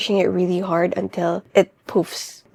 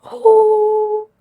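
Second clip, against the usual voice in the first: gasped oh; 12 semitones higher, 7 dB louder than usual. Real, not acted. Reaction to the book's ending.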